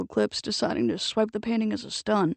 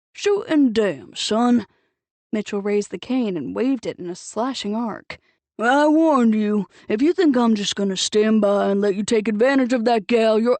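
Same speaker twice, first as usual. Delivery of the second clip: put-on angry old man's warning; 6 dB louder than usual. Old Mitchell warning Nick off the gal, loud angry bluster. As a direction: shake the cane and bellow the warning.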